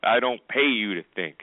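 usual speaking voice

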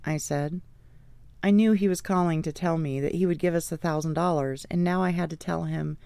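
ordinary delivery

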